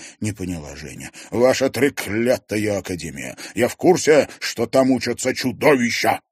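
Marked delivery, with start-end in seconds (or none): 1.3-6.2 agitated. Acted. Journalist accuses agitatedly.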